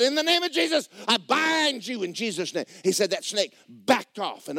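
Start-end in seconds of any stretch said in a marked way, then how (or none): 0.0-2.7 high, raised preaching shout; forceful binding command. Retold or imitated. Man binds the attacking snake, forceful shouted command. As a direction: command it with full authority.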